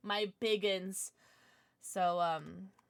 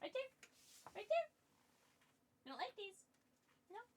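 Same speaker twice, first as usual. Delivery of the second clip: pet talk; 10 semitones higher, 13 dB quieter, faster than usual. Real, not acted. Talking to the dog.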